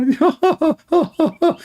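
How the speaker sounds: high, hammed-up mocking laugh. Laughing back at the opponent in mockery. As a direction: cackle at him like he's laughing.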